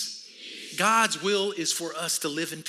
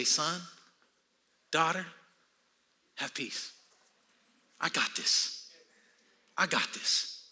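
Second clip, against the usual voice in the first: tender reassurance; 4 dB quieter, slower than usual. Acted. God speaking to a worried son or daughter, tender reassurance. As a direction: soothe them as a loving father would.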